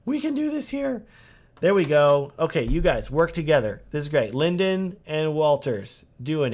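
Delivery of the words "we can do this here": much higher put-on voice, eager; hammed-up high voice of an eager viewer; mock excited viewer. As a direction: squeak it like an overexcited fan. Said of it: "He's like".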